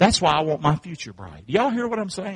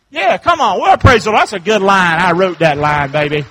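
full-voiced preacher's exclamation; exuberant preaching; 3 semitones higher, 11 dB louder than usual. Real, not acted. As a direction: cheer yourself on from the pulpit, loud. Preacher cheering on own line.

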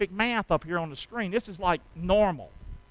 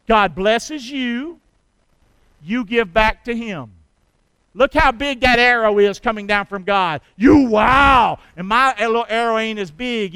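loud, slow, shouting preacher; preaching at full force; 10 dB louder than usual. Acted. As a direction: drive it home to the congregation, booming. Preacher hammers the point home.

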